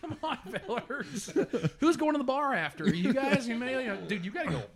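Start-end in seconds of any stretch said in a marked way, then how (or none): 0.0-1.3 high, slow, laughing hokey call; mock folksy nice guy. Mocking overly nice football players. 1.8-4.0 high, loud, laughing buddy invitation; mock friendly jock. Mocking overly nice football players.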